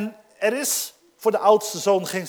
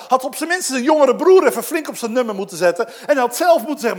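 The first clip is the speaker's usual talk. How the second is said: ordinary talk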